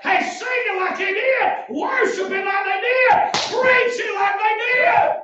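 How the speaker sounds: rhythmic, building revival shout; full-force preaching. Acted. Preacher urging church to copy old timers, full-force preaching.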